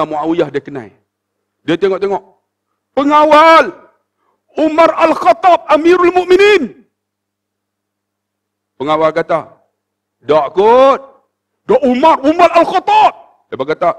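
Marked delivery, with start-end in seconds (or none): 3.0-6.8 loud urgent call, pitched high; calling the guard. Acted. Muawiyah calls out to guard. 10.2-13.1 loud, rising, insisting it really is Umar; insistent retort. Acted. Guard argues back, insisting.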